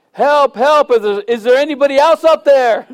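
loud, high panicked cry for help; hanging from a branch, yelling up for someone else; desperate yell. Pretend. Punchline, desperate man yelling.

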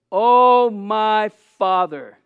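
loud opening, slow drawn-out appeal; anguished cry. Retold or imitated. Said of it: "he was so broken and weighed down".

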